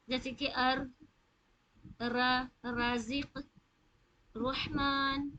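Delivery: ار را رازق رحمان with a slight trill on the ر, slow; a touch of trill on every ر; light rolled r. Shown as a demonstration. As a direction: roll the ر gently, not overdone. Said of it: "را میں تھوڑی سی تھر تھراہٹ والی sound".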